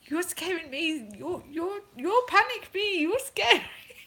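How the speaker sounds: put-on high-pitched, panicky broken English in a European accent; imitating a flustered foreigner, European accent, high; made-up European accent. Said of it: "The accent that's gonna come out".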